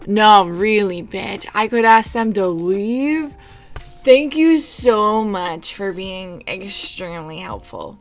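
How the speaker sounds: loud, drawn-out mock gratitude; scathing sarcasm. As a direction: lay it on slowly with heavy sarcasm. Sarcastic retort to useless suggestion.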